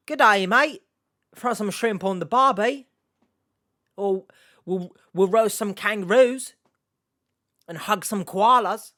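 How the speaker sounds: put-on Australian accent. Reactor sending up the Aussie impression, Australian accent. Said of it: "that's my accent".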